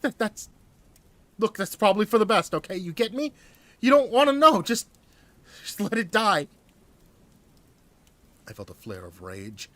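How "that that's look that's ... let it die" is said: high, loud, frightened urging; scared man begging him to drop it; croaked fear. Acted. Hostage urging the narrator to forget, high and fearful. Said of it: "He croaked".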